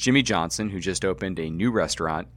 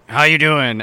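loud, higher mock-Jersey greeting; New Jersey accent; 5 semitones higher, 8 dB louder than usual. Host asks driver about lapsing into accent, loud mock New Jersey accent. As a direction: punch out an imitation Jersey hello. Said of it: "like, the New Jersey".

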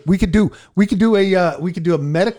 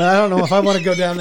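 laughing; 3 semitones higher, 4 dB louder, faster than usual. Real, not acted. Laughing at the joke.

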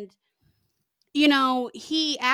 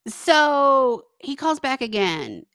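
normal talking voice

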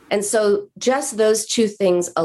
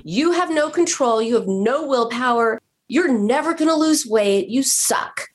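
higher mock self-berating; imitating her scolding herself, pitched up; harsh self-scolding; 6 semitones higher than usual. Patient berating herself after overeating, mock harsh. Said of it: "then she switched to you".

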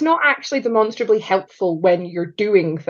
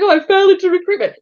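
high, quick, louder mimicry; mock everyone-says quote; 12 semitones higher, 6 dB louder, faster than usual. Guest mimicking recruiters' career story, high and quick. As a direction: imitate the stock line, pitched way up.

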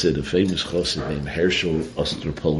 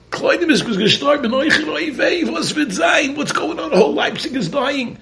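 very high, loud panicked shout; comic wailing; 5 dB louder than usual. Acted. Host lamenting that all of Leipzig is dying, high shouted wail. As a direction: wail in mock despair over the whole town. Host wails at more deaths.

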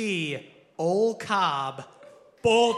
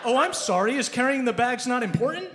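louder, higher mock apology; sarcastic; 6 semitones higher, 4 dB louder than usual. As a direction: sneer the apology at him. Balnor sarcastic to player.

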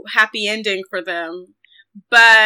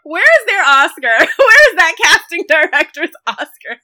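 higher, laughing mock protest; 5 semitones higher, 5 dB louder than usual. Real, not acted. Praising the film's casting to her cohost, laughing. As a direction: demand the award through laughter.

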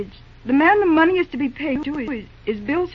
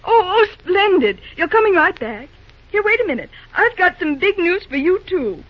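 high, bright burst of joy at good news; delighted; 5 semitones higher, 3 dB louder than usual. Acted. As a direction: let the good news lift the voice. Delighted phone news from Bill.

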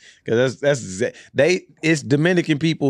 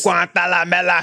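mock Spanish; 4 semitones higher than usual. Imitating Wayans Brothers Spanish line.

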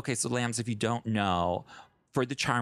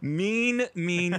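drawn-out high echo; 6 semitones higher than usual. Real, not acted. Laughing echo of co-host's verdict.

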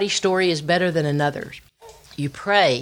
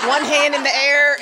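higher and louder, through laughter; delighted giggling as you picture it; laughing; 10 semitones higher, 4 dB louder than usual. Retold or imitated. Laughing retelling worship scene.

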